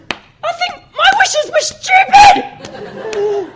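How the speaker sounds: squeaky voice. Acted. Punchline in squeaky voice.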